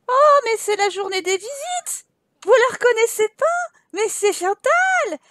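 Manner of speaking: excited surprise. Real, not acted. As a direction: greet the surprise guest like big news.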